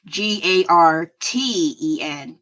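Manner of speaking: normal talking voice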